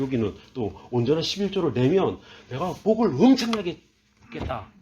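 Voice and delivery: ordinary delivery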